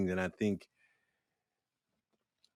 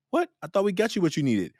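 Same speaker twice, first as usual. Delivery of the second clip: high-pitched retold disbelief; taken aback; 9 semitones higher than usual. Office worker answering a complaint, taken aback. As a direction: protest in high-voiced surprise. Retold baffled reply to email.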